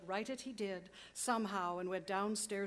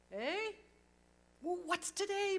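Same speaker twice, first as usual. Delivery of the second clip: higher, louder call to the street below; 10 semitones higher, 4 dB louder than usual. Acted. Scrooge calling down to a boy in Sunday clothes, loud happy call. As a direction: shout down to the boy, delighted. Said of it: "calling downward to a boy".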